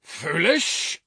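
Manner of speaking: offended roar, high and loud; giant's roar. A narrator's reading. Giant insulted by the woman, roaring in disbelief. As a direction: roar the question back. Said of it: "roared the giant".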